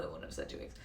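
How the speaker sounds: unvoiced aside; self-correcting whisper. Real, not acted. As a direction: whisper it to yourself. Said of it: "aside to herself".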